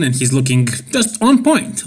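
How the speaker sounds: ordinary talk, nothing marked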